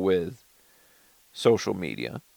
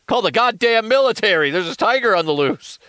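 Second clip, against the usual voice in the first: high, loud panicked call for help; mock alarm; 10 semitones higher, 5 dB louder than usual. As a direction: raise the alarm in comic panic. Mock alarm about a tiger.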